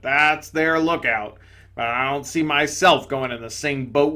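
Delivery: loud, gruff ghost exclaiming; indignant big ghost. Acted. Big ghost to the bright spirit, loud indignation. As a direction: blurt it out loudly, amazed and offended.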